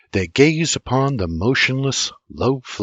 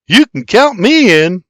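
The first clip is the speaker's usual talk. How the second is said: put-on higher, louder eager boy shout; hollering; 10 semitones higher, 8 dB louder than usual. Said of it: "William hollers".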